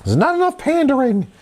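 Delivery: high-pitched mock executive complaint; studio executive. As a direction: keep the parody boss voice up high.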